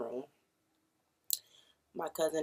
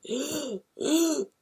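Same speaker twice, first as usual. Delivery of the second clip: sharp high gasp for air; loud panicked gasp for air; desperate gasp; 11 semitones higher, 6 dB louder than usual. Retold or imitated. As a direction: gasp like you can't get a breath. Oxygen tank ran out.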